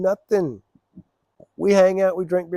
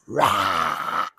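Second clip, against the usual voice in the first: mock growl; 8 semitones lower than usual. Wife suddenly furious, imitated.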